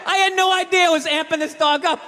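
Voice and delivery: ordinary talk, nothing marked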